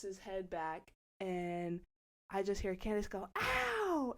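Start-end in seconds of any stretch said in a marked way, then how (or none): 3.4-4.1 high, loud "ow"; imitated pain yelp. Friend hurt on a piggyback, imitated pain yelp. Acts out Candace's cry of pain.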